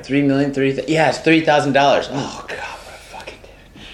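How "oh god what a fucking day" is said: hushed breathy aside; whispering. Real, not acted. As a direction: whisper it to yourself, worn out.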